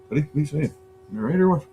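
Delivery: high, coaxing voice for the cat; man checking on his cat; pet talk. Real, not acted. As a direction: ask the cat gently what it's on about.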